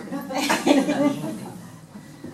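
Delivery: big loud burst of laughter; hearty laugh. Real, not acted. Telling the audience her story, loud hearty laugh.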